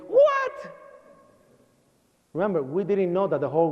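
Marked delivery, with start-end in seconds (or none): 0.1-0.7 one high, piercing shriek; yelped in a very high voice; screaming mother. Retold or imitated. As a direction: imitate a mother's shocked screech. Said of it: "and she's screaming".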